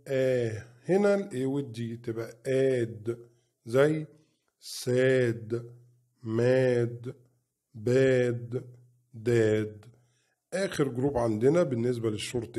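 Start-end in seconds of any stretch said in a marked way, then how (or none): 0.1-9.8 each syllable then word, like فا then فات; phonics drill. Shown as a demonstration. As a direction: sound out "آت" slowly, part then whole. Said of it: "هننطقها إزاي؟". (تهجئة صوتية مقطع مقطع)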